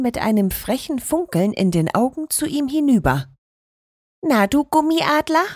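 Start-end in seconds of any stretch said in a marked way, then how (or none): 4.2-5.4 put-on high, cheeky teasing elf girl. A narrator's reading. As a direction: tease him from above, a little pert. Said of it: "sagte sie".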